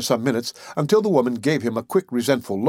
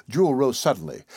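usual speaking voice

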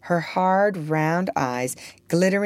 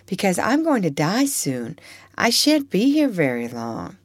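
put-on higher, tender child's voice; earnest little Eva; 6 semitones higher than usual. Eva tells the unloved child she loves her, earnest. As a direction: plead lovingly as a gentle child.

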